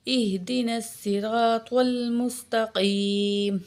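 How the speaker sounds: slow, melodic tajweed chant; Quranic recitation. Shown as a demonstration. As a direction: recite devoutly, drawing out vowels. Fatiha recitation continues.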